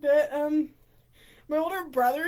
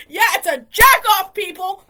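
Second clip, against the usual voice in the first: sudden loud blurt; real loud, higher outburst; obnoxious outburst; 4 semitones higher, 14 dB louder than usual. Real, not acted. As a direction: burst out with it, much louder. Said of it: "I keep saying obnoxious shit".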